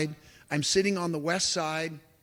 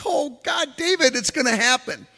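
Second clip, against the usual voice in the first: excited; 7 semitones higher than usual. Retold or imitated. Sun out on the big day.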